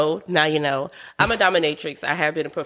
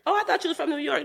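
high, softer mock-surprised voice; imitating surprised strangers; 11 semitones higher, 4 dB quieter, much faster than usual. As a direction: lightly mimic someone's mistaken assumption, high-pitched. Acting out surprised people's remark.

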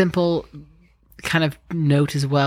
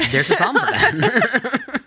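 much higher, slow, laughing through it; laughing catchphrase; 12 semitones higher, slower than usual. Acted. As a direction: ham up the running joke with a giggle. Joking repeat of a catchphrase.

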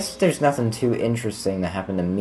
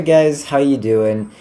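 usual speaking voice

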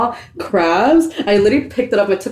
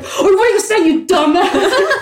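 shrieky, very high mock outrage; retold comeback pitched way up, breaking into laughter; pissed-off retort; 12 semitones higher, slower than usual. Sister throwing the menu back at her brother, pissed-off retort. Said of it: "I got fucking pissed".

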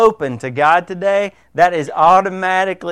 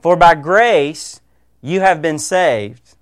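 slow, weighty stress on grace; slow scripture read stressing grace; emphatic reading; 3 dB louder, slower than usual. Shown as a demonstration. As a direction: lean hard on each word, slowly. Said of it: "the emphasis here".

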